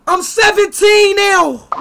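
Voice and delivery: high, loud, very slow drawn-out shout; excited shout. Real, not acted. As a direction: belt it like a victory. Gamer announces birthday in game.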